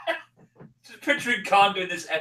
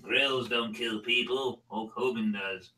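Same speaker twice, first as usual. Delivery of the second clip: slow low drawl; 7 semitones lower, 9 dB quieter, slower than usual. Acted. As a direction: drag the words out lazily. Parody voice of King of the Hill.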